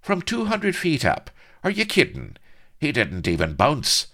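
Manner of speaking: very high, loud incredulity; scoffing. A narrator's reading. Blood answering whether Lardo recovers, scoffing loudly. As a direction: scoff at such a daft question.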